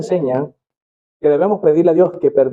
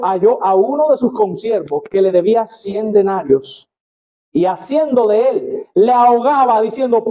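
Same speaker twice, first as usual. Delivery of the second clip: slow, solemn Bible reading with rising emphasis; measured pulpit reading of a parable; scripture reading; 4 semitones higher, slower than usual. Acted. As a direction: read the parable like a preacher at the pulpit. Reads parable aloud from scripture.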